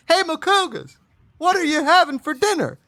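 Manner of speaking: a frozen chicken snack hollering from the freezer aisle, squeaky and high; Tyson chicken voice. Pretend. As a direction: pitch it way up like a cheery cartoon chicken. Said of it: "that's how the Tyson chicken talks".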